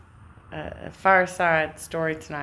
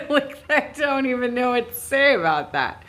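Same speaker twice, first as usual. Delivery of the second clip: higher, laughing through the words; 8 semitones higher than usual. Real, not acted. To viewers about copycat streamers, laughing while talking. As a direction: laugh it out, caught off guard. Laughing at her bigger audience.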